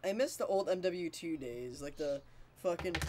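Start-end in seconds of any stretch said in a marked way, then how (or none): none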